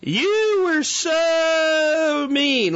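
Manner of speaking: high, slow, mock-hurt whine; slow mock complaint from offended listeners; mocking whiny listener. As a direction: whine it like a scolding fan, drawn out. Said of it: "I know I'm gonna hear from some of you guys".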